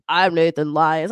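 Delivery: low, slow man's voice; deepened grown man imitation; deep grown-up voice. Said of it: "He was like".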